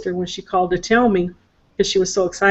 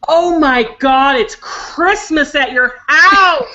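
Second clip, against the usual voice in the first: put-on slow, high and loud, a child's amazed voice; excited little granddaughter; 6 semitones higher, 5 dB louder, much slower than usual. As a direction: draw it out like a wide-eyed six-year-old. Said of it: "she went".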